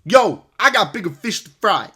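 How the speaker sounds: put-on loose, laid-back LA rapper accent, leaning on the Yo; a lightly done [name] impression with street swagger. Reviewer mocks [name]'s line, in a hammed-up [name] accent. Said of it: "let me do my best [name] accent".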